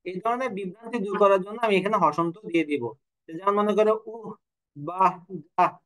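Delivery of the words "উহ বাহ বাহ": lovers' interjection. Shown as a demonstration. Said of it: "এরকম আমরা বলি না?".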